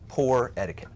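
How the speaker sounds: ordinary delivery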